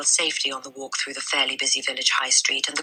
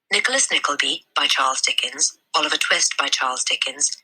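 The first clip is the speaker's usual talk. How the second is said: plain reading voice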